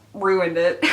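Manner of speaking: laughing at her own flop. Real, not acted. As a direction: laugh through it.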